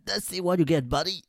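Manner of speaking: lightly voiced chummy American guard; high, matey officer ribbing you; a hint of a jokey security guard; buddy-buddy officer. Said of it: "以一种就是巴蒂巴蒂的方式".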